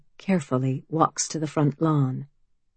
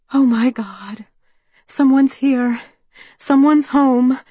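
excited; 7 semitones higher, 6 dB louder than usual. A narrator's reading. People inside excited at her arrival.

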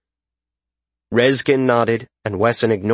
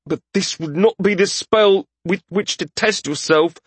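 higher, tense voice through gritted teeth; 8 semitones higher than usual. A narrator's reading. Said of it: "Wesson said through gritted teeth".

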